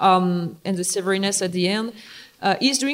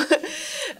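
laugh; 12 semitones higher than usual. Real, not acted. Host laughs at local guy joke.

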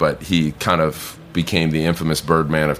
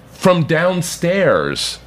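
ordinary delivery

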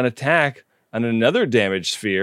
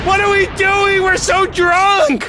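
panicked shout; 6 dB louder than usual. Real, not acted. Panicked drunk realization.

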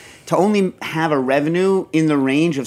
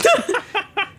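squealing laugh; high burst of laughter. Real, not acted. Laughs at own punchline.

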